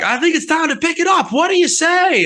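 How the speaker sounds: high frontman stage call. Acted. Imitating the singer for the crew, high stage shout. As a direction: rip off a rock singer hyping his crowd. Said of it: "I ripped off [name]".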